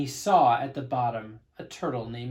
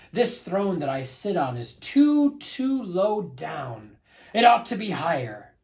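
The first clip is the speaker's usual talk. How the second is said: loud, higher, grumbling royal complaint; frowning turtle king; 5 semitones higher, 6 dB louder than usual. Acted. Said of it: "he said with a frown".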